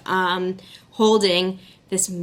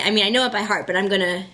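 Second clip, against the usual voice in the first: usual speaking voice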